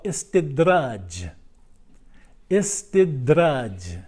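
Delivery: very slow, drawn-out ISTIDRAJ; deliberate term teaching. Shown as a demonstration. Preacher teaching listeners what we call istidraj, slow and deliberate. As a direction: say Istidraj slowly, stretching each syllable.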